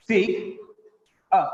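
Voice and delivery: "sig-a" with a gap before the "a"; syllable by syllable. Shown as a demonstration. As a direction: set "a" apart as its own syllable in "sig-a". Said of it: "In this syllable".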